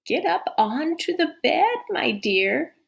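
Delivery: wolf coaxing her closer; hoarse whisper. Acted. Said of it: "whispered the hoarse voice".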